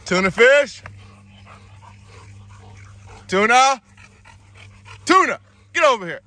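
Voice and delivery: high, loud calling out; calling from far away. Real, not acted. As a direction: call the dog across the yard. Calling dog from afar.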